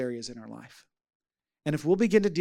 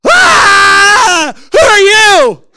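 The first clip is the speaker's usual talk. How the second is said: long high scream into a shouted question; startled revived woman; 21 dB louder, much slower than usual. Retold or imitated. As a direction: come to screaming, imitating a shocked woman. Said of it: "And she goes".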